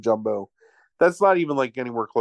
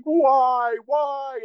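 much higher, hammed-up cries of why; mock anguish; 12 semitones higher than usual. Hercules over his dying father, mock anguished high cry. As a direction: wail it like an over-the-top movie hero.

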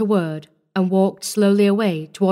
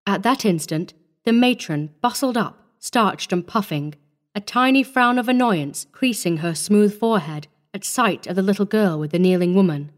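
ordinary delivery, nothing marked

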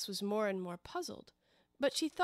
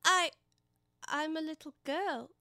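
higher, halting, unsure answer; doubtful; 7 semitones higher than usual. Acted. Alice after so many changes that day, halting doubt. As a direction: hesitate after "I", then offer it tentatively. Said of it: "rather doubtfully".

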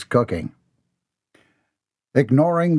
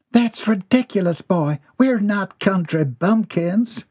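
much higher prim mother; a mother sniffing at her son; high prim mother's rebuke; scolding mother; 8 semitones higher than usual. A narrator's reading. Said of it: "mother scolds son".

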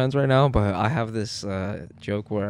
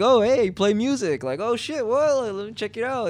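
higher, bright, eager reaction; excited quote; 10 semitones higher than usual. Retold or imitated. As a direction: sound pleasantly surprised, pitched up. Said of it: "He's like".

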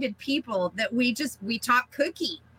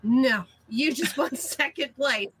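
amused correction. Retold or imitated. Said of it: "she goes".

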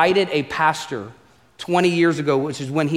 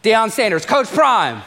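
ordinary talk

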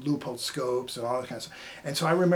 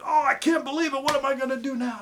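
much higher and louder, despairing; retold dismay; 11 semitones higher, 5 dB louder than usual. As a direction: wail it like a man whose hunt is ruined. Steelworker upset over missing bolt.

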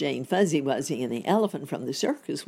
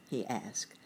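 ordinary delivery

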